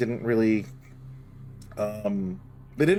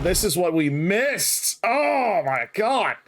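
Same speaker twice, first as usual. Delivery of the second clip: awed; 5 semitones higher, much slower than usual. Real, not acted. Awed by destruction.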